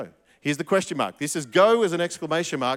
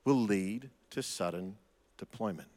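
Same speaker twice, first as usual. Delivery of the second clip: usual speaking voice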